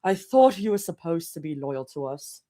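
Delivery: British RP, steady and unbroken; better British accent. Acted. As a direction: have a careful second go at an RP British accent. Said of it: "I can do a better British accent".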